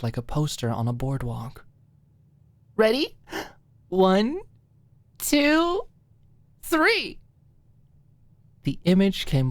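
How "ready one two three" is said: high, eager countdown building to the reveal; excited countdown. Acted. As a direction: count it down like unveiling a surprise. Maud's big reveal countdown.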